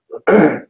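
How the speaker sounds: hard rasping throat clear; harsh throat clearing. Real, not acted. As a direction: clear water from your throat. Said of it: "went into my lung instead".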